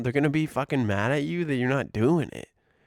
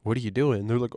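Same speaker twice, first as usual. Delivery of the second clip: normal talking voice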